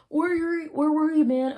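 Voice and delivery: high-pitched mock friend; high, put-on questioning voice; acted friend's question.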